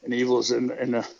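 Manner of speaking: ordinary delivery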